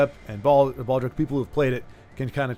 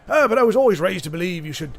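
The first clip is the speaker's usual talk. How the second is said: higher, hammed-up British accent for the cowboy's line; 5 semitones higher, 3 dB louder than usual. Streamer redoing a game character's line, hammed-up British accent. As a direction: redo the line as a posh British gentleman. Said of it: "you must speak with a British accent".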